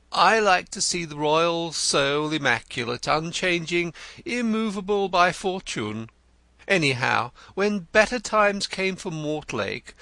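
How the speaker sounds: Denzil the poet. A narrator's reading. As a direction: play him florid and eager. Narrator voices Denzil the poet.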